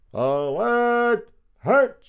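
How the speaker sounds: very high, drawn-out marching order; military command. A narrator's reading. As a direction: call the troops to march. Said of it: "The command went to the depths".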